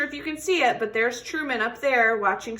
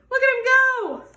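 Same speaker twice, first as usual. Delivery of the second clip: much higher, louder delighted call; excited cheer; 13 semitones higher, 8 dB louder than usual. Real, not acted. Teacher urging kids to watch the tortoise, thrilled.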